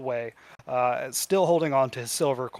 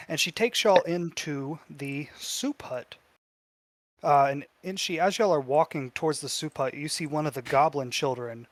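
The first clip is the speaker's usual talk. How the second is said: normal talking voice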